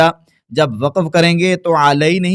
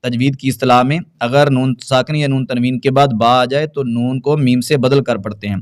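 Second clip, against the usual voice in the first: usual speaking voice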